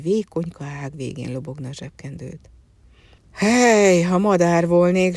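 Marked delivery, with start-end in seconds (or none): 3.3-5.2 loud sighing cry of longing; sighing wish. Acted. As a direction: heave it out like a hopeless sigh. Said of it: "sóhajtotta".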